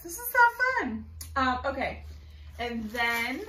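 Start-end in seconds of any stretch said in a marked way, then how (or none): 0.0-1.0 excited. Real, not acted. Bird scattering toy on desk.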